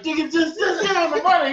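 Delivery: high, loud, impatient plea; retold exasperation. Bystander urging the arguing man to pay up, exasperated.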